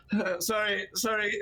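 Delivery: sheepish apology. Acted. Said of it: "woman who's just sat there like".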